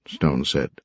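plain reading voice

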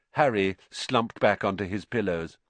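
ordinary delivery, nothing marked

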